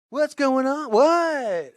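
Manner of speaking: high, loud mock confusion; oblivious shouting. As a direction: call out like you can't hear a thing. PSA lady who can't hear.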